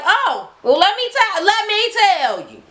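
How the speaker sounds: high, gleeful build-up; eager gossip. Retold or imitated. Twins eager to tell the reunion story, high and gleeful. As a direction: sing it out, dying to spill. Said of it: "they were like".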